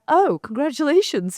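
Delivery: high, loud startled congratulations; surprised congratulations. Retold or imitated. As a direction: blurt it out in surprise. Producer reacts to news.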